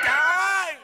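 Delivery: long wailing yell; hammed-up cry of shock, dragged out; anguished shout. Groom hearing his bride eloped, anguished shout.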